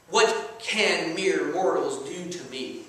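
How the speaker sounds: cocky psalmist, dropping in pitch and taking his time; cocky David. Acted. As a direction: recite it slowly as a bold challenge. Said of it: "He was cocky".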